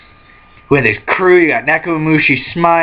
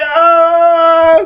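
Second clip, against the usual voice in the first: scream; 13 semitones higher, 5 dB louder than usual. Retold or imitated. Nami voiced screaming.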